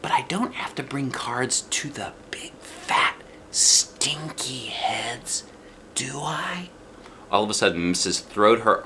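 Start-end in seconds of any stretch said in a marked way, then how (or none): 0.0-6.7 breathy hushed question; soft child's whisper. A narrator's reading. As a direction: whisper it, hoping for a no. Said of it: "I said real soft".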